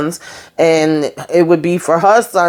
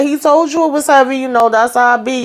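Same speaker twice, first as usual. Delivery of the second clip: pitched way up, I-told-you-so sass; high, exasperated told-you-so; sassy told-you-so; 7 semitones higher than usual. Real, not acted. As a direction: scold her like a know-it-all friend. Speaker's own sassy retort.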